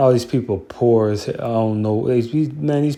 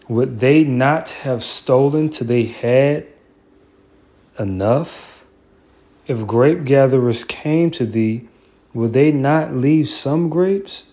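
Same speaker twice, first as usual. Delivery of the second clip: scripture reading; 3 semitones higher, slower than usual. Acted. Reading Obadiah scripture aloud.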